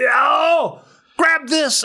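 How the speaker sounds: high, excited mock command; frantic order. Man grabbing weapons, frantic and high. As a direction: imitate a man in a frenzy.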